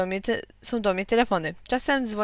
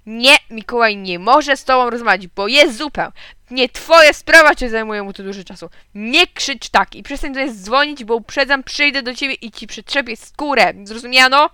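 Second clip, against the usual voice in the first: loud, raised, red-faced scolding; angry shout; 2 semitones higher, 7 dB louder than usual. A narrator's reading. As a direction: tell the caller off with threats. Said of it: "zrobił się cały czerwony i krzyknął". (gniewny krzyk taty)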